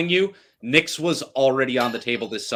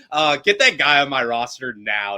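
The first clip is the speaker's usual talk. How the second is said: ordinary talk, nothing marked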